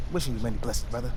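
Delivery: sarcastic kindness after the insults; mock-gracious farewell to a rival; sarcastic blessing.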